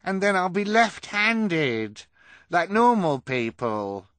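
very high, loud, slow exclamation; elated character voice, stretched out; overjoyed. Acted. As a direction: cry it out in sheer delight. Kevin overjoyed, jumping up.

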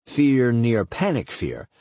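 usual speaking voice

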